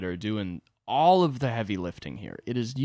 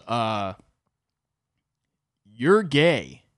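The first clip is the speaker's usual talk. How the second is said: dumb comeback; 7 dB louder, much slower than usual. Retold or imitated. Gordon firing back at his friend, loud dumb blurt. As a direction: blurt it out like a proud dim jock. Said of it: "and he goes".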